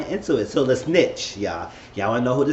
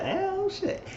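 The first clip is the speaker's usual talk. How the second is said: much higher, softer "oh shit"; high squeaky surprise; 7 dB quieter than usual. Real, not acted. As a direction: squeal it in shocked amusement. Own reaction, laughing.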